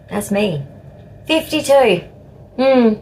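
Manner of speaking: usual speaking voice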